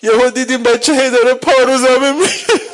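high, loud sobbing lament; grieving preacher sobbing; tearful wail. Acted. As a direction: weep the words out.

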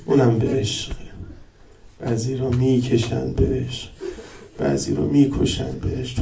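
soft, low grieving recitation; mournful lament. Acted. Preacher laments softly to mourners. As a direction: mourn quietly. Quiet mournful rowzeh passage.

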